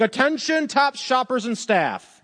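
mock announcement to all the shoppers; store intercom announcement. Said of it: "the intercom over the".

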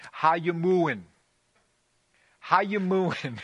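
loud, hammed-up New York moo voice; New York cow accent. Preacher mocks a New York cow to the congregation, hammed-up accent. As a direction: ham up a New York cow asking how you're mooin'. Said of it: "they say something like this".

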